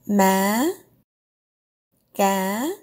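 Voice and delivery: Ma and ga start high and rise; rising Ma, ga. Shown as a demonstration. Teacher gives rising-tone examples. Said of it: "Start high and go higher".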